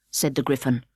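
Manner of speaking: usual speaking voice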